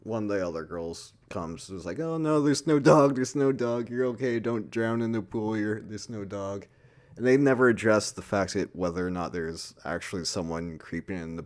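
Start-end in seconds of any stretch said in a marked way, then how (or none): 2.0-6.7 mock reassuring. Friend calming scared girl.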